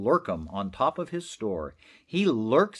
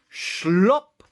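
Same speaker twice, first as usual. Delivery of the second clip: a loud, sudden slop noise; mock slop sound; 5 semitones higher, 7 dB louder than usual. Narrator voicing the hose, loud slop. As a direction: smack out the sound of the hose dropping. Sound of the whisper-mophone dropping.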